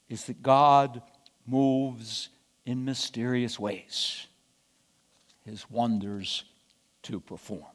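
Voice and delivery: low, slow and softening; slow solemn saying, fading low; hushed reverence. A narrator's reading. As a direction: sink gradually into a solemn hush. Solemn saying closing the point.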